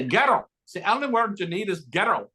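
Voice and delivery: imitating Billy's Irish coaching; mock Irish accent. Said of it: "he'll say".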